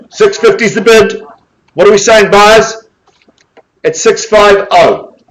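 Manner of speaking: high, loud drawn-out countdown; auctioneer's call. Acted. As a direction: call the bid and count it down, slowing on each call. Auctioneer calling for bids.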